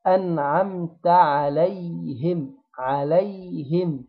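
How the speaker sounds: عليهم with a thin open ع and a plain sukun on the ي; slow recitation. Shown as a demonstration. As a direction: draw out عليهم slowly, light and even. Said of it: "نجيب العين مرققة مفتوحة".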